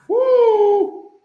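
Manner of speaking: high, loud whoop of approval; big celebratory woo; excited whoop. Real, not acted. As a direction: cheer the line like a hype man. Cheering the quote again.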